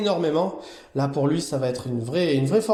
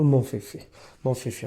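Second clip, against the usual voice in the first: ordinary delivery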